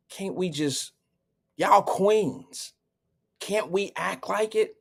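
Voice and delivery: slow, soft, low plea to calm the women; soothing peacemaker. Retold or imitated. Walmart shopper calming the arguing women, soft and slow. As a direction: gently talk them down like a kind elder. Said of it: "I coulda just been like".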